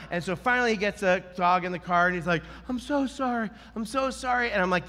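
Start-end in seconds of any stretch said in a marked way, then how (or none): 2.7-4.5 high, softened imitation of the owner; sheepish apology. Owner apologizing once the dog is in, meekly. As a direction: repeat it meekly in a raised voice.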